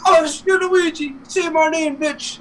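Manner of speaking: very high-pitched mock showdown; retold cartoon exchange. Retelling the showdown between Luigi and Mario, high mock voice. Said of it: "And he's like".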